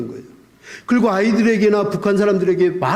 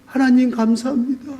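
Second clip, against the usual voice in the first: grateful prayer; 7 semitones higher than usual. Retold or imitated. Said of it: "그러면서".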